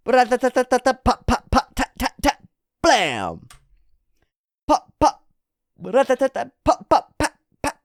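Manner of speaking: high-pitched rattling vocal gunfire; imitated gunfire. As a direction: rattle off machine-gun bursts and pops. Imitating gunfire in manga action.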